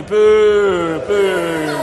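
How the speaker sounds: shouted pantomime boos; loud boo. Pretend. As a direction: lead the children in booing. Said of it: "We need to boo and hiss".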